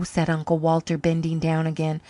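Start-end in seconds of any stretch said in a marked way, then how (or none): none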